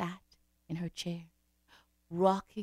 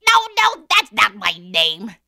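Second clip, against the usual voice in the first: little man voice; 15 dB louder, slower than usual. Acted. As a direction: sing it out like a gleeful imp. Little man refuses the guess.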